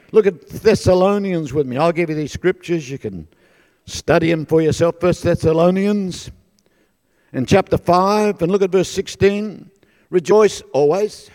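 loud, emphatic scripture call; preaching. Real, not acted. Preacher directs congregation to Thessalonians verse, emphatic preaching. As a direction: project the reference to the whole room.

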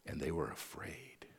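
whispered like a secret told to the room; dramatic whisper. Acted. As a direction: drop to a whisper for suspense. Dramatic hush after the miracle.

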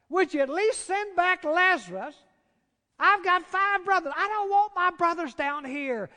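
loud, high begging voice; desperate plea. Acted. Rich man in hell begging Abraham to send Lazarus. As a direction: plead like a man in torment.

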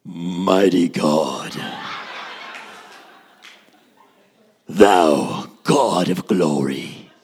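very deep, slow, loud mock prayer; exaggerated preacher's praying voice, booming from the depths; put-on praying voice. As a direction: intone it hugely low, dragging every word. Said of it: "what happened to your voice?".

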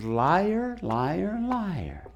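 quiet drawn-out chant, first words rising; gently sing-song calling out; soft mock accusation. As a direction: softly tease out each accusation, falling on the last. Jesus voiced answering the denier.

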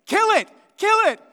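high, loud shouted command; acted shout, punched out hard; imitated yelling. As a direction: yell it at her as an order. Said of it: "you can hear Aaron yelling at her".

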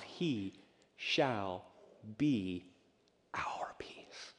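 each word apart, whispered at the end; slow fading whisper. Acted. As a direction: breathe out "our peace". Scripture spoken, slowing to whisper.

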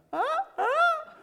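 very high, laughing Huh? Huh?; mock-innocent kid fishing for a reaction; cheeky kid. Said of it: "I'd look at the rest of the room like".

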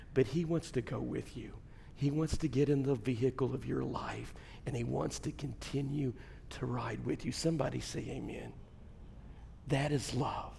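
hushed, low sermon appeal; tender preaching. Real, not acted. Preacher assures congregation of God's love, tender hushed preaching. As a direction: speak softly and sincerely, with long pauses.

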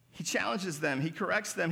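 usual speaking voice

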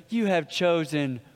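a hint of the old knight, quiet and grave; solemn knight. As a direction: intone it like an ancient guardian. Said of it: "the knight looks at him and goes".

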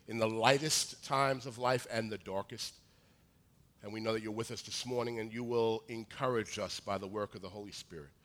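usual speaking voice

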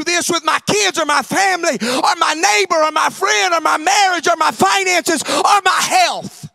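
loud, wounded protest that it is unfair; indignant protest. Acted. Believer complaining to God that it's unfair, indignant shout. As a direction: shout out the grievance.

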